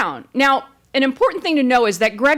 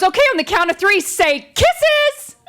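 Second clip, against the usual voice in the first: chirpy photographer; 7 semitones higher than usual. Retold or imitated.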